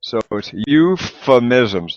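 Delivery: euphemisms slow, said "you-fuh-miz-ums" with an F; sounded-out word. Shown as a demonstration. Teacher modeling a new word for students, slow and clear. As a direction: stretch out euphemisms syllable by syllable. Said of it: "the P H is an F".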